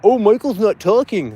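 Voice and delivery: high, slow whiny mock voice; sneering put-on viewer whine; mocking whiner. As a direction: imitate a complaining viewer. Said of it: "they'll complain about".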